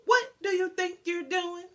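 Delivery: loud, high reproach; stern challenge. Acted. Old owl scolds the fox, loud and stern. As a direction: catch the fox in the act.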